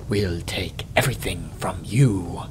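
low, soft demon hiss; a hint of a hissing demon at your ear. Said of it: "hisses another of the demons".

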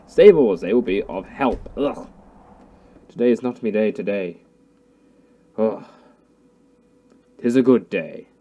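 normal talking voice

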